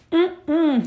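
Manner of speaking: put-on high childish "mm-mm" of refusal; refusing hum. He refuses, shaking his head.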